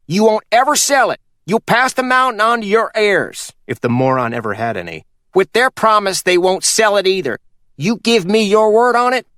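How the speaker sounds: put-on a sly old mountain man giving orders; Purvis voiced. Purvis presses for his word.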